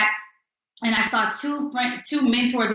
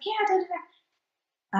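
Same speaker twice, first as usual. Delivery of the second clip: upbeat; 10 semitones higher, 5 dB quieter, slower than usual. Retold or imitated. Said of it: "I'm just all upbeat".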